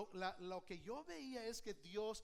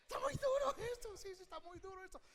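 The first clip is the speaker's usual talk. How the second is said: much higher and fast whine; hammed-up lament; mock whining; 8 semitones higher, faster than usual. Shown as a demonstration. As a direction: whine it like a weary believer. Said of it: "a ver, hágale así".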